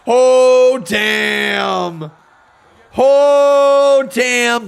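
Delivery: loud burst of shock; stunned exclamation. Real, not acted. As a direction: blurt it out in disbelief. Stunned by the match result.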